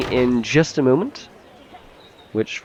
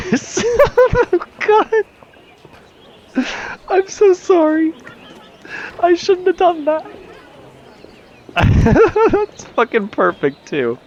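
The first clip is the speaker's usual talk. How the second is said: laughing hard; slower than usual. Real, not acted. Cracking up at a game joke.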